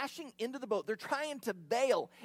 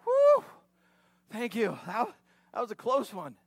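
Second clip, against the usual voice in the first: relieved; 8 dB louder than usual. Retold or imitated. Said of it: "instead of going".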